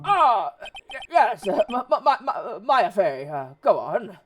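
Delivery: loud, stammering lawyer role; flustered Grossberg. Acted. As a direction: stumble over the name with pompous bluster.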